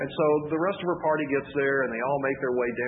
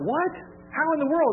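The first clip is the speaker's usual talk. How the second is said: much higher and louder gasp of disbelief; can't believe her ears; stunned disbelief; 13 semitones higher, 4 dB louder than usual. Retold or imitated. Stunned at the found contact.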